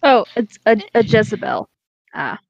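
ordinary talk, nothing marked

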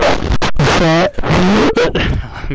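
big burst of laughter; loud laugh. Real, not acted. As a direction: howl with laughter.

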